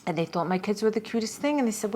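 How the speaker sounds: ordinary delivery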